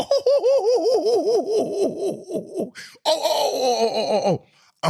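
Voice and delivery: long run of high hooting laughs; real high-pitched laughter. Real, not acted. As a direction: crack up in high "oh-oh-oh" whoops. Cracks up at his own line.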